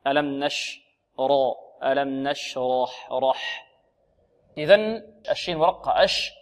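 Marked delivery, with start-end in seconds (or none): 0.1-3.7 نشرح slowly, thick dark را; dark back vowel on the r of نشرح; heavy raa. Shown as a demonstration. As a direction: correct model, dwelling on the heavy رح. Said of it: "خطأ".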